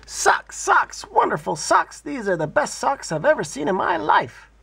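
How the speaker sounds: put-on high, thrilled child's cry; gleeful little girl shouting about her socks; excited yell. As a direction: squeal it out with joy. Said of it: "Tina ran home and yelled".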